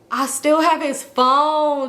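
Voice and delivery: slow, high, stunned; dawning shock; shocked realization. Retold or imitated.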